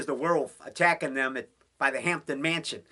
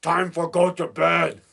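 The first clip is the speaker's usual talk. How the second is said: slow, halting Lobo line said once more; a hint of lumbering Lobo, slow; Lobo impression; 3 dB louder, slower than usual. Horror fan repeating Lobo's bedtime line, slow mock voice. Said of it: "He says that in The Unearthly".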